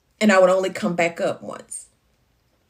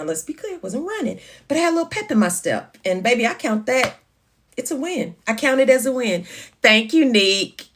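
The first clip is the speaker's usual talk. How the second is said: ordinary talk, nothing marked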